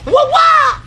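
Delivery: high, shouted "wah, wah"; punchy shouted impact noise; loud imitated "wah wah". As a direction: yell out a loud, high-pitched wah-wah sound effect. Mimics noise of a blow.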